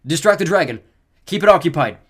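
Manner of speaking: shouted plan; urgent order. A narrator's reading. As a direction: direct them while fleeing. Beckendorf gives orders while fleeing.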